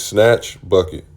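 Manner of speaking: ordinary delivery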